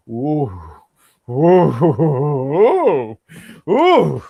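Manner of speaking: real helpless laughter, building; cracking up. Real, not acted. Cracks up reading a sign.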